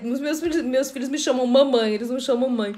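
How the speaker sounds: ordinary talk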